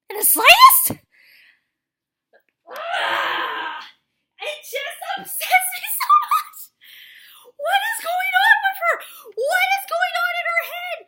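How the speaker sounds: high, loud, half-laughing outburst of disbelief; exasperated shout. Real, not acted. Ranting to viewers about the heroine, exasperated shouting. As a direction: yell in comic frustration, voice cracking.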